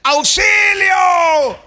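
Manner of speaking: high, drawn-out shout for help; a trapped man calling out for help; desperate cry for help. Acted. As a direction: yell up from the bottom of a well. Preacher acts man in well.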